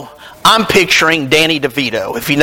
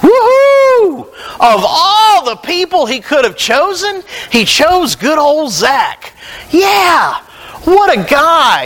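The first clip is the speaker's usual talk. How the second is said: mock rejoicing crowd; 9 semitones higher than usual. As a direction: cheer like a delighted crowd. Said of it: "The crowd just breaks out in rejoicing".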